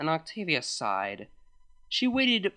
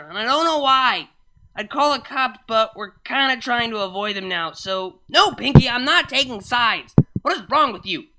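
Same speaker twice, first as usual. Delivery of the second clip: higher, urgent, flustered plea; frantic pleading; 7 semitones higher, 5 dB louder than usual. Acted. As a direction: beg for pickup in a panic. Panicked call amid brawl.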